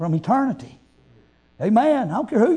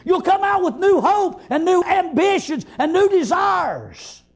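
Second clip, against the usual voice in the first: rousing, driving pulpit delivery; fervent preaching; 4 semitones higher than usual. Real, not acted. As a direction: preach with mounting zeal, stretching phrase endings. Preaches with force throughout.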